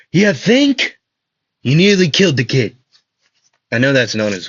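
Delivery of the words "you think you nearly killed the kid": higher, louder sarcastic scold, quickening; redone Zora voice. Acted. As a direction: redo it properly, snapping at the reckless one. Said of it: "let me say that".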